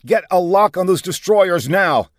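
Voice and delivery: high, loud command; forceful order. A narrator's reading. Commander ordering sniper wing to target destroyers, forceful. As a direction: order it done immediately.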